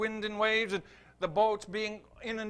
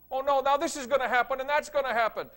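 mock worry; 5 semitones higher, 9 dB louder than usual. Mocking anxious people's talk.

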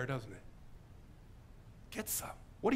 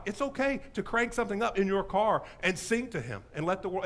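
usual speaking voice

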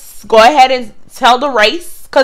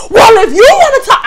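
much higher, louder yell; 11 semitones higher, 5 dB louder than usual. Retold or imitated. Clerk yelling back at the customer in the store. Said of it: "mind you, I'm yelling at her".

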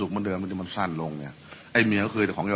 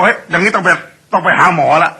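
high, loud cracked rasp; hoarse husband voice; 8 semitones higher, 9 dB louder than usual. Retold or imitated. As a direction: complain in a strained hoarse croak. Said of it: "เสียงแหบ". (ผัวเสียงแหบบ่นว่าต้องไปหาหมอ)

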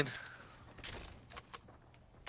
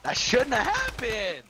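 frustrated outcry; 12 semitones higher, 4 dB louder, slower than usual. Real, not acted. Frustrated after dying.

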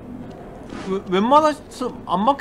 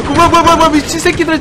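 panicked; 8 semitones higher, 5 dB louder, faster than usual. Real, not acted. Panicked at attackers.

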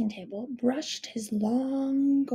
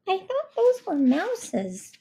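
put-on high little girl voice; 10 semitones higher, 4 dB louder, slower than usual. A narrator's reading. Girl's doubtful voice.

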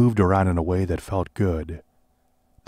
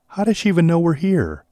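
high, louder alarmed question; 10 semitones higher, 4 dB louder than usual. Acted. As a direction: blurt it out, rattled.

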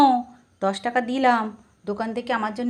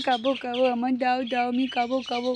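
fussy mock whimpering; pretend baby crying; 6 dB quieter than usual. Baby refused Cadbury keeps begging, pretend crying. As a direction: whine like a baby who was refused. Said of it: "তোমার baby কাঁদছে কেন".

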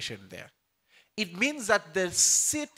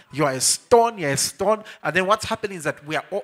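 ordinary talk

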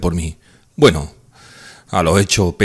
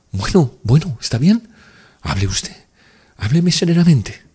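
put-on higher young woman's voice, pressing and urgent; higher, eager plea to speak; emotional Nastenka; 8 semitones higher, 4 dB louder than usual. A narrator's reading. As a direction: blurt it out with sudden, unexplained emotion. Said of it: "con una emoción inexplicable".

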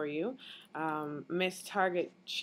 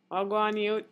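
Jamaican patois Wagwan, youth, higher and louder, calling out to a friend; lively Jamaican patois greeting; 4 semitones higher, 5 dB louder than usual. Shown as a demonstration. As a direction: hail a mate in Jamaican patois, Wagwan punched up. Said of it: "but in Jamaican dialect".

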